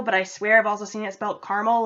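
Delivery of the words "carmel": "caramel" as two syllables, CAR-mul; "caramel" with the middle vowel dropped, opened with CAR; caramel without middle A. Shown as a demonstration. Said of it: "like C A R M E L".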